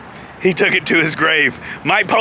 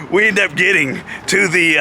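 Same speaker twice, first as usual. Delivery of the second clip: plain reading voice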